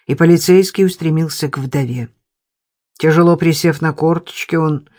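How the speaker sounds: ordinary delivery, nothing marked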